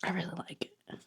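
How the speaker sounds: soft whisper of delight; admiring whisper. Real, not acted. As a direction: whisper it, taken by the cover. Admiring the new cover.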